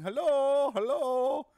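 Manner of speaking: high, loud mocking Indian-accented hello; heavy Indian accent. Annoyed caller mimicking the operator, heavy Indian accent. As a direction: holler it in an exaggerated Indian accent. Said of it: "very heavy Indian accent".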